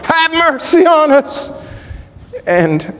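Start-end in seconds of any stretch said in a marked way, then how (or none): 0.0-1.5 very high cry for mercy; shrieking plea. Retold or imitated. Two blind men begging Jesus, shrieking. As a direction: shriek it at the top of the voice. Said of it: "shrieking out with the top of their voices".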